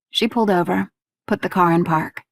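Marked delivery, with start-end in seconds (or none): none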